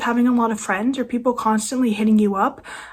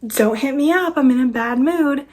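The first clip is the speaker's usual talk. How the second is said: higher-pitched mocking quote of a sulky post; mock moody post; 3 semitones higher than usual. Imitating people's Snapchat stories, mock moody. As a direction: mimic a moody poster, pitched up. Mimicking moody social media posters.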